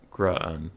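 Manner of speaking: Grun said with an "uh" vowel. Shown as a demonstration. Streamer correcting his mispronounced name, careful Grun with an uh. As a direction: say Grun to rhyme with "run". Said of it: "pronounced with an uh sound".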